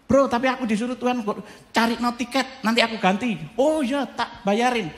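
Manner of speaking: high, animated phone talk; excited call between two friends; lively phone chat. Retold or imitated. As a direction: retell the friend call brightly.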